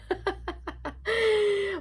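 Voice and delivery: high-pitched giggling peal; high laugh. Real, not acted. Laughing while explaining the family tree, high giggle.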